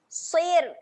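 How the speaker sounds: ordinary delivery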